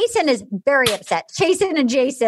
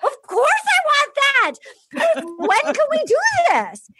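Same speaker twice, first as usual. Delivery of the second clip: much higher, hammed-up excitement; thrilled mock squeal; 12 semitones higher than usual. Woman told a proposal could be next, thrilled mock squeal. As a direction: squeal it like an impatient bride-to-be.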